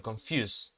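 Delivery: "confuse" ending on an unvoiced s, konfjus; final s in confuse. Shown as a demonstration. Teacher showing the last sound. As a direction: end "confuse" with a hissed s. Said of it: "Ese es el último sonido".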